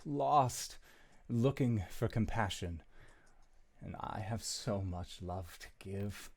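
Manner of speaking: lightly voiced, soft-spoken charmer; smooth-talking character. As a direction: play a softly wooing story figure, with pauses. Plays a story figure answering players.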